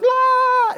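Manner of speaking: high shrill squeal of excited women; a delighted high-pitched squeal; squealing ladies. Retold or imitated. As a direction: squeal like a crowd of thrilled ladies. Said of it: "all the ladies are like".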